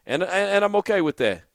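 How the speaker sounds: ordinary delivery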